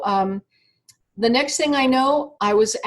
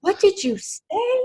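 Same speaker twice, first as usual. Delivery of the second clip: sharply high, stunned what; shocked disbelief; 10 semitones higher than usual. Retold or imitated. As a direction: cry out in astonished disbelief. Shocked at own outburst.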